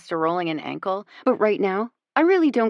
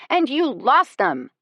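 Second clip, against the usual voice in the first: slow, higher, louder retort; cutting; 6 semitones higher, 6 dB louder than usual. A narrator's reading. Lexi cuts Alexis down in their quarrel, cutting. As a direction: land each word like a blow.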